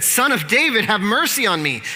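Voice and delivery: high, loud cry for mercy. A narrator's reading. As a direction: shout it out, pitched well up. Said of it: "he only shouted louder".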